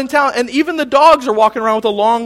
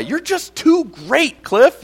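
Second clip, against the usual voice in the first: mock scolding; 8 semitones higher, 4 dB louder, much slower than usual. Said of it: "I've never been scolded that way".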